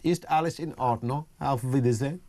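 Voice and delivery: put-on German accent, lightly showing off; amateur's stock German phrases in a German accent. Shown as a demonstration. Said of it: "the accent's not that bad".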